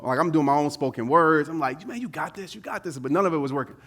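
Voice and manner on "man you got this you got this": very soft, private pep talk; psyching himself up. Retold or imitated. Nervous skydiver to himself before the jump, hushed psyching up. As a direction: coach yourself under your breath. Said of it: "trying to use all the things that I know to psych myself up".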